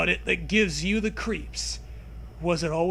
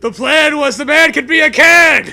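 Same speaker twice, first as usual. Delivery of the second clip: loud, high joking shout; gleeful shout; 8 semitones higher, 15 dB louder than usual. Real, not acted. Topping the co-host's joke with a gleeful shout. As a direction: yell the punchline triumphantly.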